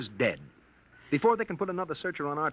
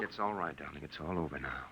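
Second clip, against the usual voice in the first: tender comfort; 11 semitones lower, 10 dB quieter than usual. Acted. Comforting her after crash.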